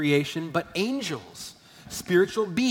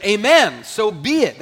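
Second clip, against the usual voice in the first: loud, higher affirming amen; emphatic, raised-voice amen; congregational amen; 4 semitones higher, 11 dB louder than usual. Acted. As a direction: call out agreement like a listener. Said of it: "someone says".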